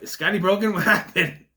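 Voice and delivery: loud, high, laughing aside; amused mock alarm. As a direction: laugh at yourself while imitating puzzled viewers. Jokes about viewers reacting to stutter.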